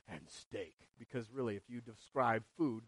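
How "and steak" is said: a muttered, much lower and softer aside; low mock mumble; mock grumbler. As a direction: tack it on like a grumpy guy in the back. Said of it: "some guy there saying".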